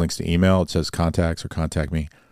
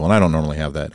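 ordinary delivery

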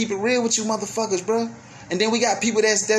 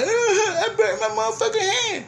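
high, whining cry-laugh; whiny crying kid. Retold or imitated. Kid crying to parent after burning hand, whiny high cry. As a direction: whimper like a burnt kid. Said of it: "come right to you like".

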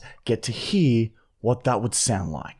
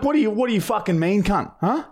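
mock confrontation; 7 semitones higher, faster than usual. Acting out an angry challenge.

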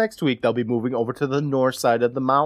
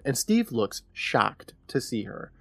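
ordinary talk

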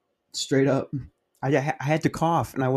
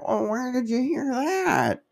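put-on high, slow old-lady voice; drawn-out, high mock old woman; seventy-year-old woman; 13 semitones higher, slower than usual. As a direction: imitate an elderly woman, pitched way up. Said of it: "it's like a seventy-year-old woman".

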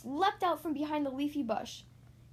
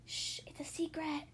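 softer, secretive shushing; hushed secret; 3 semitones higher, 7 dB quieter than usual. A narrator's reading. Thistle keeps her costume secret, hushed. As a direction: hush it like a secret.

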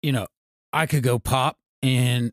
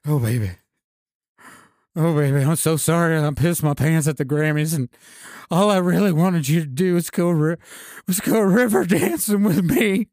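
tearful apology; 6 semitones higher than usual. Acted. Tearful making-up scene.

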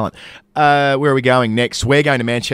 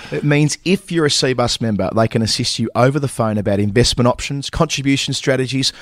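scripted ad read. Acted. Hosts act out sponsor ad read.

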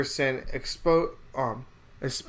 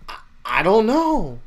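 a higher, louder put-on preacher voice, hesitant; Joel Osteen impression; 7 semitones higher, 7 dB louder than usual. Joel Osteen dodging Larry King's question, hesitant mock voice. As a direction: imitate a vague televangelist dodging the question. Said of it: "he has that, like, accent".

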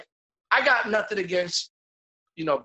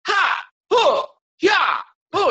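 high, loud hammed-up battle cries; mock martial arts kiai; 11 semitones higher, 6 dB louder, much slower than usual. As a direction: parody cheesy fight yells. Mocking fight scene shouts.

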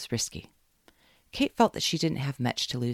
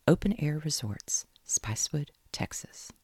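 whisper; 3 semitones higher than usual. Acted. Kate reading the link.

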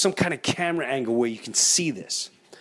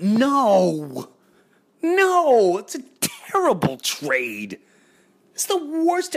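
anguished outcry; 7 semitones higher, much slower than usual. Retold or imitated. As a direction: wail it like a heartbroken fan. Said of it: "I went".

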